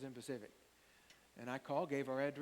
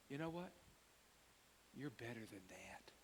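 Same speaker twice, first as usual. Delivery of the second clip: soft, low-voiced gentle rebuke; quiet retelling; 5 semitones lower, 11 dB quieter than usual. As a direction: say it softly, like a kind correction. Gibson's quiet words retold.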